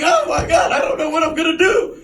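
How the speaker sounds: high, rushed panic; frantic. Retold or imitated. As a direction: blurt it in a mock panic.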